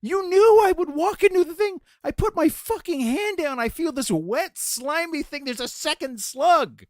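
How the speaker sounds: high-pitched, emphatic mock tirade; imitating outraged wife. Wife berates husband over second slug, high incredulous imitation. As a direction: rant in an exaggerated high wife voice. Wife recounts being pranked twice.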